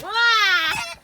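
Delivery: high loud yelp of fright; mock scared scream. Woman acts out her scream if the goanna escapes, high and loud. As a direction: shriek like he just got out. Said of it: "I'm like".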